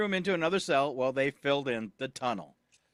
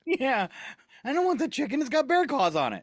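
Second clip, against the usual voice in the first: indignant homeowner; 7 semitones higher than usual. Pretend. Voicing Lori after bear story.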